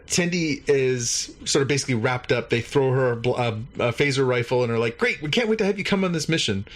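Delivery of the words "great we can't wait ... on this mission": high, quick welcome; mock enthusiasm. Commandos welcoming the new recruit onto their mission, quick mock enthusiasm. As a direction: rush it out with retold eagerness.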